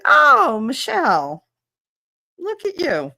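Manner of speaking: high, loud teasing call of her name; mock teasing boy. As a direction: tease her like the boy in class.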